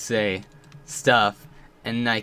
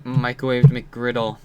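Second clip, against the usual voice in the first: normal talking voice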